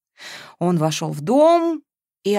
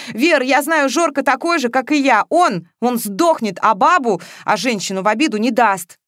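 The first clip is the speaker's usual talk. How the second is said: heated outburst; 5 semitones higher, 5 dB louder than usual. A narrator's reading. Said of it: "не выдержал Матвеев".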